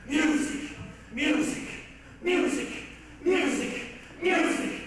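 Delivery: from a hissed repeat up to a high shouted cry; building obsessive outburst. Acted. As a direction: let the word swell until it breaks into shouting. Composer raving over his obsession.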